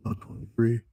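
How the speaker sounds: lightly voiced, indifferent cow; a hint of a deadpan cow voice; talking cow. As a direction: answer as a cow who has no idea. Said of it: "Cow's like".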